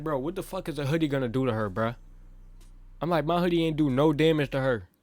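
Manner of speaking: exasperated pushback at the principal; heated protest. Retold or imitated.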